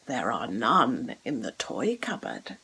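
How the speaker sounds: put-on a low, soft, knowing reply from the boy; lightly voiced boy. A narrator's reading. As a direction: say it like a clever child with a secret. Boy's cheeky answer.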